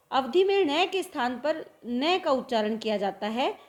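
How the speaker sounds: ordinary talk, nothing marked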